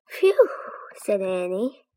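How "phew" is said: loud, high sigh of relief; relieved phew. Acted. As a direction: blow out the relief. Relief after escaping bear.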